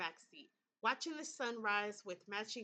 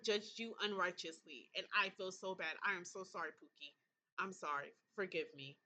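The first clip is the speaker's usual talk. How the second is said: soft tender apology; 4 semitones lower, 3 dB quieter than usual. Real, not acted. Player gently apologizes to Thomas, lower and softer.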